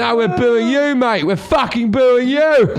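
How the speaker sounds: high, loud indignant yell; imitating an irate audience member; angry crowd heckle. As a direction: shout the correction at him, outraged. Crowd turns on the comic.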